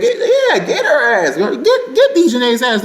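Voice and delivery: laughing, high-pitched hype shout; hyped cheering. Real, not acted. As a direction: cheer her on, laughing and hollering. Cheering a fight on video.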